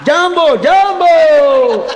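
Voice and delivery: high, slow hammed-up shout of jambo with a laugh; playful mock chant, laughing through it; mock African cheer. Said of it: "આફ્રિકાના આવેલા".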